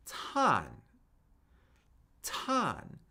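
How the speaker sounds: fourth-tone "tan", a clean downward fall. Shown as a demonstration. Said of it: "and then it steadily falls".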